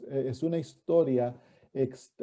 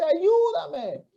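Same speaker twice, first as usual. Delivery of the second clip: high, raised voice mimicking a desperate shout; imitated cry for help; 12 semitones higher than usual. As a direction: call out for help from behind. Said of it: "la mujer grita detrás de Jesús".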